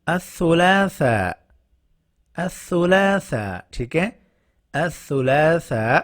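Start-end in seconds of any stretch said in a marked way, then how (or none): 0.1-3.6 الثلاثاء drawn out slowly as ath-thulatha; slow pronunciation. Shown as a demonstration. Teacher slowly introducing الثلاثاء to students. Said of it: "اس کا مطلب ہوتا ہے". 4.7-6.0 الثلاثاء drawn out slowly as ath-thulatha; slow pronunciation. Shown as a demonstration. Teacher slowly introducing الثلاثاء to students. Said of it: "اس کا مطلب ہوتا ہے".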